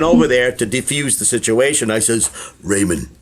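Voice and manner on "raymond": much lower, soothing put-on voice; low mock-coaxing reply to a fussy celebrity; calming voice. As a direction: talk a star down gently. Soothing Ray over potato salad.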